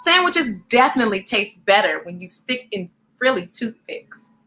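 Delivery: lively storybook read-aloud; reading to children. A narrator's reading. Reading a picture book aloud to young viewers, lively.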